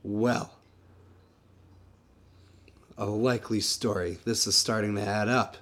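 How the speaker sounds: quiet suspicion. Acted. Detective muttering conclusion.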